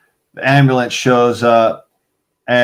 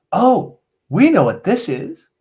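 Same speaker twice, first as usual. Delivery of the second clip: knowing doctor; 8 semitones higher than usual. Retold or imitated. Doctors quoted, high slow.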